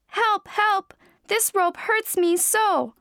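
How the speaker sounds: high, pleading little cat cry; crying for help. Acted. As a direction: beg for help, high and pitiful. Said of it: "cried Blackie".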